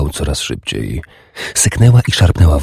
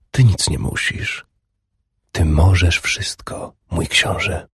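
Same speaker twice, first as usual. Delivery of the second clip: hushed, softer, a little slow; seductive whisper; 5 dB quieter than usual. A narrator's reading. Urdis whispering to her prince. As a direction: whisper it close and coaxing.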